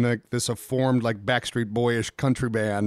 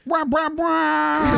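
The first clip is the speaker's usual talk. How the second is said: muffled wah wah talk; much slower than usual. Pretend. Mocking sponsor read muffled.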